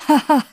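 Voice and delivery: höheres, bitteres Haha; heiseres Lachen. Acted. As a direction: lache heiser und kraftlos. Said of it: "lachte darauf der Erste". (heiser bitteres Lachen)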